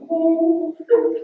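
high, loud mock wail; retold bawling. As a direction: cry it out in an overwhelmed falsetto. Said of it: "I start bawling 'cause I'm sorry".

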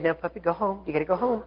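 soft, sweet talk to a puppy; hushed, kindly urging; gentle coaxing. Retold or imitated. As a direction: coax a little dog home very softly. Lady coaxing a little dog.

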